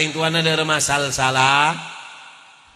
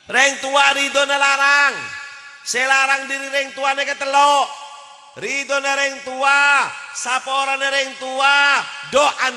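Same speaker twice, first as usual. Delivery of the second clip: preaching at full force; 8 semitones higher than usual. Acted. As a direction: urge the fathers forcefully. Preacher exhorts about parents. (khotbah berapi-api)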